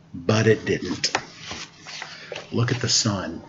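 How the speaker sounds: soft, low aside; quiet. Pretend. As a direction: drop to a hush. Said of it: "hushed aside at picture".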